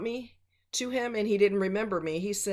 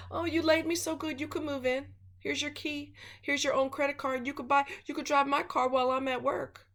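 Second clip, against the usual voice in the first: mock doting woman; 6 semitones higher than usual. Mocking women who let men in.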